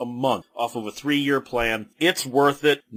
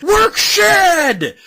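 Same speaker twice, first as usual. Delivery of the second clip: a much higher and louder retold crowd holler; mock yell; 10 dB louder than usual. Fans heckling Bruce from the seats, loud mock holler. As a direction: bellow it like a heckler. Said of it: "they're screaming".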